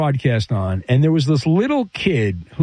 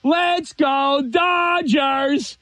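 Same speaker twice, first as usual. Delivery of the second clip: put-on high, drawn-out kid's chant; slow, high-pitched mock child cheer; cute little kid voice; 9 semitones higher, much slower than usual. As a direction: imitate a little kid cheering, stretching every word. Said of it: "with his cute little kid voice".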